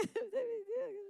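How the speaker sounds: high, soft mock crying wail; a hammed-up whimper; mock sob. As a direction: fake a little sob. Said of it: "yo empiezo a llorar".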